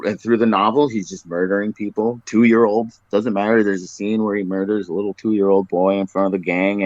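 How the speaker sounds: ordinary talk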